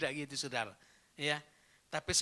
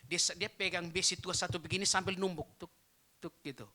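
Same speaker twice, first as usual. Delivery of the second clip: ordinary delivery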